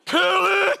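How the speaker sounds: high, loud imitated screaming of a three-year-old; child screaming. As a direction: scream it like a kid standing on his seat.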